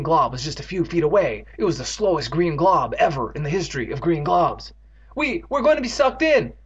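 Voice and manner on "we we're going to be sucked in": scream. Acted. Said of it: "Evan screamed".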